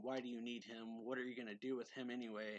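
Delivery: ordinary talk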